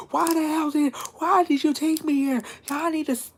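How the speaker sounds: very high-pitched mock scream; retold screaming. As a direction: squeal it in panicked protest, without much volume. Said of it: "and I'm screaming".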